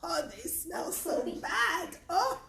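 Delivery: hammed-up revulsion at a smell; disgusted. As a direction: moan as if about to gag. Gagging at the smelly sacks.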